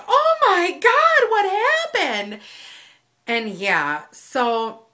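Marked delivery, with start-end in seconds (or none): 0.1-2.4 high, loud, slowed alarm; shocked friend. Retold or imitated. The friend reacting to the scream, shocked and high. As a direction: gasp it out as the startled friend. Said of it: "my friend was just like".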